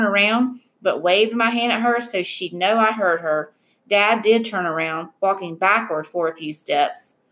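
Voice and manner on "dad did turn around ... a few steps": ordinary delivery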